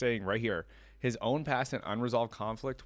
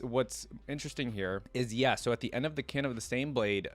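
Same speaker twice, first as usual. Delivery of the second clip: ordinary talk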